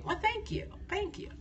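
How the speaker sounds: a shy, flattered murmur; thanking a viewer for a compliment, softly; soft bashful thanks. Real, not acted.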